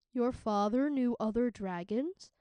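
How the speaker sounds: ordinary delivery, nothing marked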